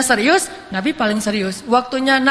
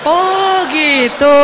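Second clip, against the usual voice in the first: long drawn-out, high, loud 'oh'; dawning realization; 5 semitones higher, 5 dB louder, much slower than usual. Retold or imitated. Companions finally get the Prophet's joke, drawn-out realization. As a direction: stretch it out as the penny drops.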